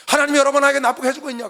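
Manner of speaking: impassioned pulpit shout; forceful preaching. Real, not acted. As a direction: throw the question out at full force. Preacher challenges the congregation loudly.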